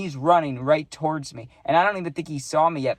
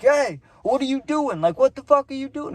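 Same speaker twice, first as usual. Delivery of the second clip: much higher, louder retold yell at the guys chasing him; shouting at the three dudes chasing his plug; 11 semitones higher, 4 dB louder than usual. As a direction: bark it out across the street. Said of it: "all I could think to do was yell".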